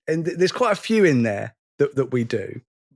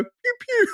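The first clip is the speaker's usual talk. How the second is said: squeaky laser pew. Retold or imitated. The guy in the show mimics a Space Invader laser, squeaky and high. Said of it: "I go".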